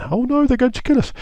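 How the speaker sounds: very high squeaky panic; a high squeal of alarm at being chased; panicked Israelites. Acted. Israelites see Egyptian chariots.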